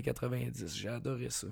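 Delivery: voice giving out. Real, not acted. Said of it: "je suis en train de perdre ma voix".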